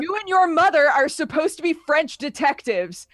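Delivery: loud, high comic indignation; upset rant. Retold or imitated. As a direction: rant in exasperated disbelief.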